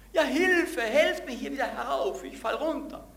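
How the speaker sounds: high, loud frantic cry for help; desperate shout. Acted. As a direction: shout up from the cliff edge. Man on cliff yelling.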